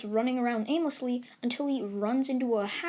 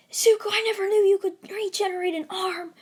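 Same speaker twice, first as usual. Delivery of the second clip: higher, louder cry of disbelief; shocked outburst; 8 semitones higher, 6 dB louder, faster than usual. Acted. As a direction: stare at him and yell it out. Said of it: "he's like".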